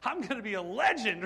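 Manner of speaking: loud, high gleeful brag; cocky excitement. Pretend. A pretend Moses crowing with excitement. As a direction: crow about being the chosen hero. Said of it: "he would have went".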